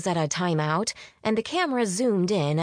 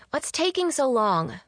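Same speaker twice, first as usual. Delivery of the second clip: higher, impatient grumble; grumbling; 7 semitones higher than usual. A narrator's reading. Hungry friend waiting on the couch for dinner, grumbling. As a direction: complain about the wait. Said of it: "Faye grumbled from the couch".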